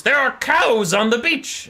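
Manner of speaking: high, loud, slow mad bellow; mad rage. Acted. Mad pirate lord raging to his captain, hammed-up bellow. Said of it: "in one of his mad fits of rage".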